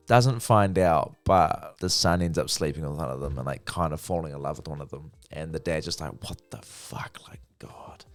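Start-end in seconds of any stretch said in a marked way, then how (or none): 6.2-8.0 whispered retold disbelief; stunned whisper. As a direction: whisper it, aghast, as the stunned dad. Retold stunned dad's reaction.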